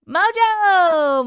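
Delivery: loud call of a name. Real, not acted. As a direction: call the dog across the room. Calling out to the dog.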